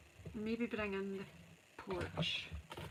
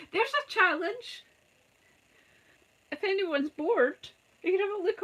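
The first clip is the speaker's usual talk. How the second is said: a laughing dare, pitched up high; 9 semitones higher, 6 dB louder than usual. Real, not acted. Teasing bored viewers with a dare, laughing. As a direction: laugh it out as a cheeky challenge.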